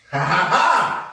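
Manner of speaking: loud, drawn-out ha-ha cackle; loud laugh. Real, not acted. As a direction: laugh it out loud at your own joke. Laughing at his own joke.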